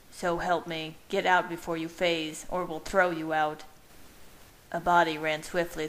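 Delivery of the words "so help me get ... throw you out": plain reading voice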